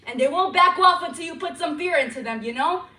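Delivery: building into a loud angry rant; rising rant. Acted. Ranting about abuse.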